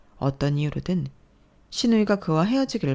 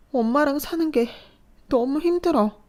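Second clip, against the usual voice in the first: despairing; 10 semitones higher, 3 dB louder than usual. Acted. Daughter telling mother she is finished, despairing. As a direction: let it all collapse.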